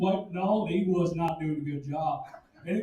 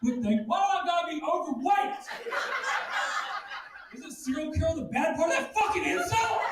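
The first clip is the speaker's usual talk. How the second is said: mock outrage; 9 semitones higher than usual. Real, not acted.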